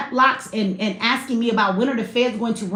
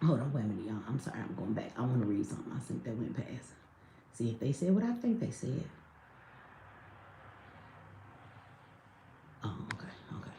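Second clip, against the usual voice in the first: quiet aside; 15 dB quieter than usual. Real, not acted. Reading chat, quieter aside.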